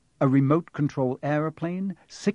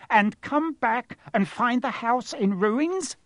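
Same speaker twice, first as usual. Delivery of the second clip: much higher, slow, sneering snarl; 10 semitones higher, slower than usual. A narrator's reading. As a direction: spit it out like she swallowed a lemon. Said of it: "she snarled".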